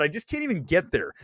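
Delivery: ordinary talk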